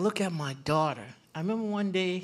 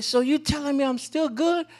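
high, louder imitation of a hopeful little girl; incredulous relief; 7 semitones higher, 5 dB louder than usual. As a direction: ask it like a child suddenly reprieved. Retold daughter's sudden relief.

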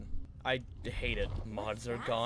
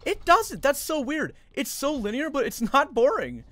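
higher, louder excited gushing; amazed raving; 10 semitones higher, 6 dB louder than usual. Real, not acted. Streamer gushing to chat about how good Ruin is. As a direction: rave about the game in disbelief.

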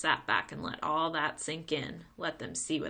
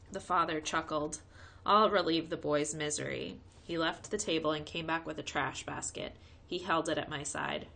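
ordinary delivery

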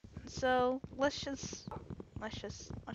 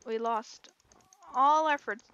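normal talking voice

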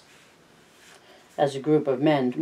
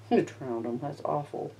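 hushed appalled aside; 9 dB quieter than usual. Real, not acted. Reader's aside reacting to story.

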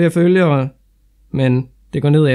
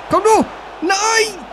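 excited yell; much slower than usual. Real, not acted. Gamer yelling at match.

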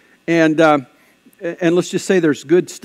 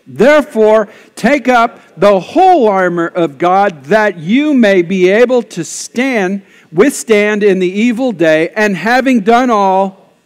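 loud, slow, raised public reading; scripture declaimed; 4 semitones higher, 6 dB louder, slower than usual. Real, not acted. Pastor leads congregation reading scripture out loud, declaimed. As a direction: proclaim it slowly and forcefully for all to join in.

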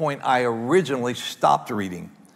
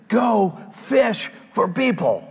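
slow commanding shout; 6 semitones higher, much slower than usual. Acted. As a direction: call it out slowly like a command. Climax of sermon point, slow shout.

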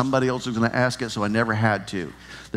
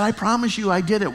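put-on high, rushed, pleading little boy; panicked pleading kid; 8 semitones higher than usual. Ten-year-old begging his teacher, high and panicky. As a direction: beg the teacher like a scared fifth grader. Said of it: "And I was panicked".

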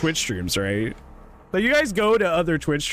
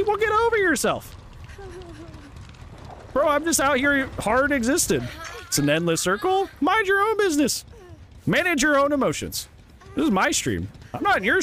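much higher, sassy scolding of chat; shrill-free high mock lecture to viewers; mock indignant rant; 11 semitones higher than usual. Real, not acted. Ranting at salty chat.

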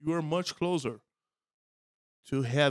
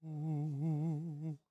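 spooky low ghost voice; 3 semitones higher, 10 dB quieter than usual. Shown as a demonstration. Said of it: "you will land into something like this".

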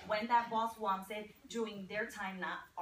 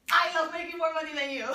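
loud, higher, quick comeback; playful boast; 5 semitones higher, 8 dB louder, faster than usual. Retold or imitated. Breadwinner wife answers husband's point about money, boasting loudly. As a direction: crow it proudly at a partner.

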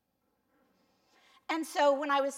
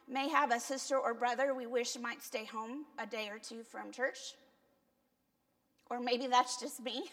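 ordinary delivery

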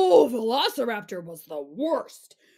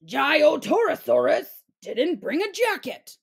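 usual speaking voice

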